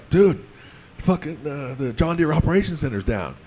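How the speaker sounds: a hint of a Midwestern dude accent; American accent. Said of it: "that's my American accent".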